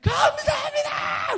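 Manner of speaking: high, loud tearful cries of thanks; shouting through tears. Retold or imitated. Big soldier weeping, shouting thanks to God. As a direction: cry out thanks at the top of the voice. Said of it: "이렇게 소리 지릅니다".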